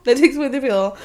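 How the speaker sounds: repeating the friend's point with a laugh. Real, not acted. Laughing, repeating her own line to friends.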